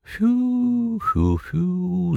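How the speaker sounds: high impressed whistle; whistling at the high fever; admiring whistle. Acted. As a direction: whistle in amazement. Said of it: "zagwizdał z podziwem".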